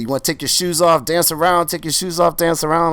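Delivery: high, loud preacher call. Retold or imitated. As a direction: rouse the congregation to dance. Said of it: "He said".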